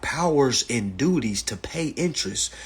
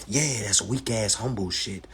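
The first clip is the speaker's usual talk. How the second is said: normal talking voice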